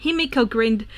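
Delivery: plain reading voice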